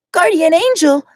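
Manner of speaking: put-on high, surprised girl; high Glacia voice. Glacia startled by the angel, high voice.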